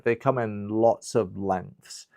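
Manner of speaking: ordinary talk, nothing marked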